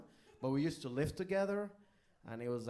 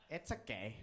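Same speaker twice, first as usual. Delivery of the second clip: unimpressed; 3 semitones lower, 7 dB quieter than usual. Retold or imitated. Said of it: "her reaction was like".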